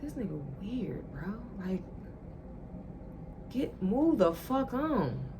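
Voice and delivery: muttered, unimpressed aside; quiet dismissal. Retold or imitated. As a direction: brush him off under your breath. Quoting her own dismissive thought.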